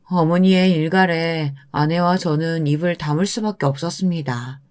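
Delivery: plain reading voice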